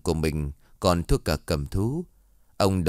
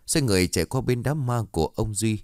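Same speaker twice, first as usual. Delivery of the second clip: ordinary delivery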